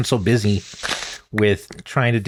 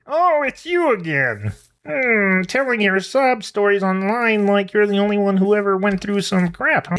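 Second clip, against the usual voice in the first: much higher, slow and snide chicken; sassy chicken voice; 9 semitones higher than usual. Acted. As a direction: play the sarcastic chicken talking back. Voicing the back-talking chicken.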